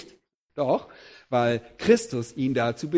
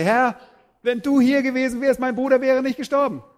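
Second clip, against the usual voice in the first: raised, louder anguished plea; grieving plea; 8 semitones higher, 4 dB louder than usual. Acted. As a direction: cry out the loss to the Lord. Maria falls at Jesus' feet.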